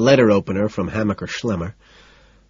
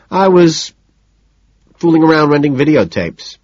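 higher and louder, pleased with himself; a smug little boast, higher and louder; 7 semitones higher, 6 dB louder than usual. A narrator's reading. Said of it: "I say, pleased".

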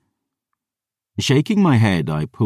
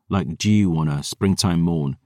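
plain reading voice

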